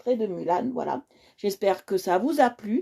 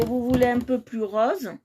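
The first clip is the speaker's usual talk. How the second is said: ordinary delivery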